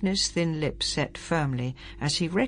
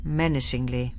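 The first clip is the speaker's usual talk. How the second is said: ordinary delivery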